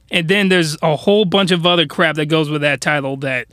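usual speaking voice

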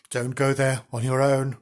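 ordinary delivery, nothing marked

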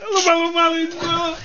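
high, laughing half-shout; you embarrass me, laughing hard; laughing scold. Real, not acted. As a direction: scold him through laughter. Laughing rebuke of co-host.

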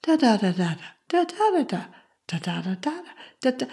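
lilting wordless "da, da, da"; soft "da, da" rising and falling like soothing speech; emotional music. Shown as a demonstration. Showing a soothing mother's voice as "da, da", lilting. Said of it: "this is the emotional music".

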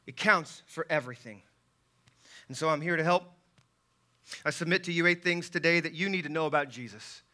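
usual speaking voice

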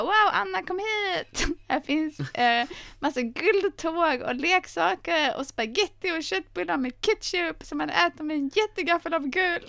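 put-on high, excited little boy voice; boy in the film. Boy lures Anna to his gold house, high excited voice. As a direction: play the tiny boy luring Anna with treats. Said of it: "Han bara".

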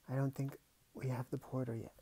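ordinary delivery, nothing marked

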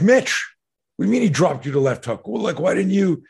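high, loud incredulous coach, retold; exasperated disbelief. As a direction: act out dismay at a student's blunder.

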